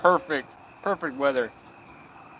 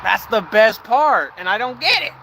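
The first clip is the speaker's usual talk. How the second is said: exaggerated complaint; 7 semitones higher, 9 dB louder than usual. Real, not acted. Mock complaint about peace.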